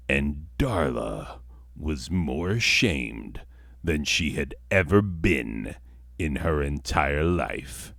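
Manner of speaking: ordinary talk, nothing marked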